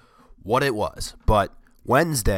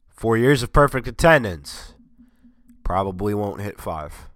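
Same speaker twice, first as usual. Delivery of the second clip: usual speaking voice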